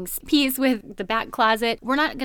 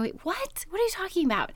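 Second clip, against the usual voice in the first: high, softer, baffled reply; acted bewilderment; 8 semitones higher, 5 dB quieter than usual. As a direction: act thrown by a stranger's outburst. Baffled reply to the screaming woman.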